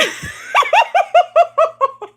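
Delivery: high, long helpless laughter; laughing through the memory. Real, not acted. Recalling getting caught, long high laughter.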